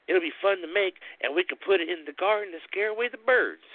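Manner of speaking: high, eager brother suggesting a scarecrow; Thomas, voiced high. Thomas pitching the scarecrow idea to Alfred, high and eager. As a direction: pitch it up, cheerful and keen.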